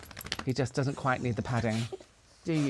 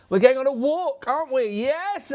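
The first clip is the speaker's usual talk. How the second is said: much higher and louder, sing-song to the dogs; telling the dogs they are going on a walk, high and sing-song; high dog-talk voice; 11 semitones higher, 4 dB louder than usual. Real, not acted. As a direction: wind the dogs up with a high excited coo. Teasing dogs about walk.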